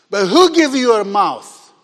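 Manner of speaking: loud, indignant challenge acted as God. God rebuking Moses over his excuses, indignant. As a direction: throw the question out loudly, offended. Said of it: "God gets indignant and says".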